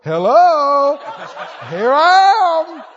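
high, loud, drawn-out comic call; God calling out. Retold or imitated. God trying to get the begging seeker's attention, comic calling out. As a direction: hail someone oblivious in long, stretched syllables. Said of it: "he's going".

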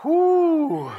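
drawn-out, sliding cries of amazement; awestruck exclamations. Acted. Preacher acting out believers seeing God's glory, awestruck. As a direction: gasp in wonder, stretching each word. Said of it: "will be in glory with God going".

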